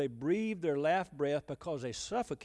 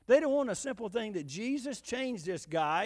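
normal talking voice

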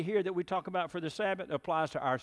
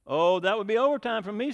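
louder, whiny dodge of the work; lazy excuse; 5 semitones higher, 8 dB louder than usual. Acted. Cow dodges baking work, louder whiny excuse. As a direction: make the excuse sound put-upon. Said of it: "said the cow".

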